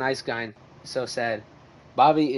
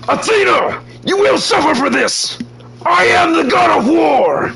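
shrieking, much higher, much louder god of war; Kratos voice; 13 dB louder, slower than usual. Retold or imitated. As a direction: roar it like a furious god, slowly at the end. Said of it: "That was me as Kratos".

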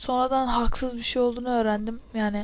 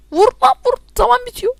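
much higher, loud panicked urging; frantic urging; 12 semitones higher, 7 dB louder than usual. Real, not acted. Gamer pressing his teammates as the timer runs out, frantic urging. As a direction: push the team on in a panic.

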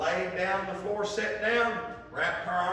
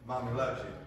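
mother's soothing; 5 semitones lower than usual. Retold or imitated.

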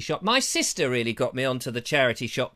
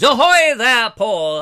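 loud hearty pirate hail; 6 semitones higher, 10 dB louder, slower than usual. Pretend. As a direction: call out like an old sea dog. Pirate greeting to viewer.

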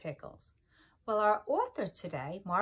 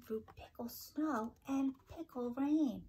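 storybook whisper; 5 semitones higher than usual. Acted. Teacher whispering a line of the picture book to children.

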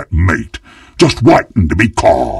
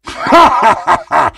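villainous cackle; 11 semitones higher, faster than usual. Acted. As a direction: cackle hungrily over the prey. Villain gloats over fresh prey.

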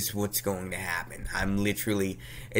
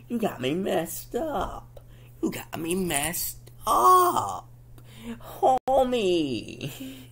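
slow, high, drawn-out grievance; exasperated complaint; 11 semitones higher, much slower than usual. Retold or imitated. Child to parent after being startled onto floor, drawn-out exasperation. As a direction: stretch each word out in high mock outrage.

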